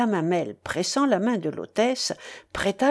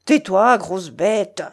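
plain reading voice

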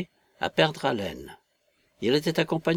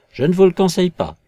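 quick, loud, high warning; assured; 7 semitones higher, 10 dB louder, faster than usual. A narrator's reading. Invisible man warning Marvel against failing, assured. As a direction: warn him with full assurance. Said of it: "dit la voix avec une tranquille assurance".

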